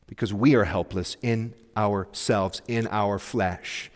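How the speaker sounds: ordinary talk, nothing marked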